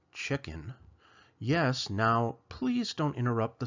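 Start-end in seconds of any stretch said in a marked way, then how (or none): none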